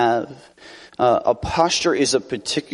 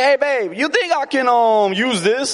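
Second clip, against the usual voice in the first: butter up babe with a high sugary ask; sweet-talking his wife; 12 semitones higher than usual. Retold or imitated. Preacher imitating himself sweetly asking his wife. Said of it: "I was like".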